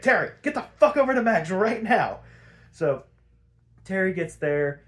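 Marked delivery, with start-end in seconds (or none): 0.0-2.2 urgent order. Retold or imitated. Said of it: "and he's like".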